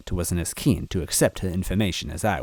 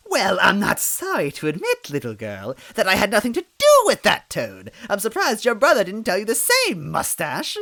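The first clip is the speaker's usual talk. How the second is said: high, louder witch voice; Cackletta, sneering; 14 semitones higher, 5 dB louder than usual. A narrator's reading. Villain taunting Mustache, high sneering witch voice. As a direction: sneer it down at them.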